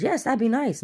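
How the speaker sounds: high, bright rush of relief; eager relief. Retold or imitated. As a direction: grab the offer like a lifeline. Relieved acceptance of help.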